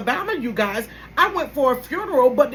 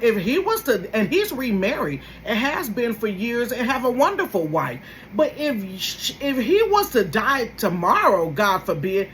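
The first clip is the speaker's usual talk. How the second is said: normal talking voice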